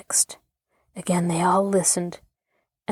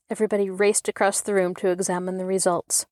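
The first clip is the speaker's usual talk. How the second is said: ordinary delivery, nothing marked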